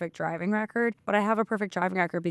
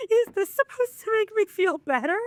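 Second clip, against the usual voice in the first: very high, squeaky wail; mock distressed retort; 4 dB louder than usual. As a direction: squeal it in hammed-up disbelief. Retold upset retort to his story.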